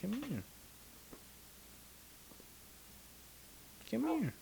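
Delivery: high soft coaxing call; calling the cat. Real, not acted. Calling the cat back up, high soft coo. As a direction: coax the cat over sweetly.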